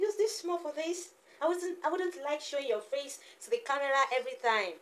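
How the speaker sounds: high-pitched motherese; high, coaxing baby-talk. Pretend. Same words repeated in baby talk.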